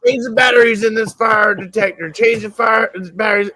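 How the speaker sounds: high, nagging mom voice; annoyed mom. Retold or imitated. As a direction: nag like an irritated mother. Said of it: "And Mom's like".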